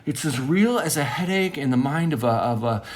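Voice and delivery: high, loud, impassioned outpouring; fervent preaching rant. Acted. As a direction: proclaim it like a revelation, carried away. Fervent rant at full force.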